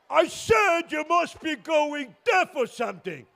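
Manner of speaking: high, emphatic repeat; screaming to be heard. Acted. Driver repeating himself to the bus driver, high exasperated call. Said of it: "why are you screaming".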